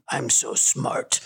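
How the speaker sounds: drawn-out, very slow mock boast; cocky self-mockery. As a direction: stretch it out like a smug know-it-all. Acted cocky retort to dad.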